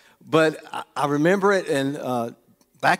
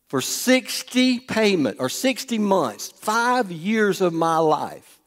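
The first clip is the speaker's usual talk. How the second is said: slow emphasis; 6 semitones higher, much slower than usual. Real, not acted. Stressing the long loan.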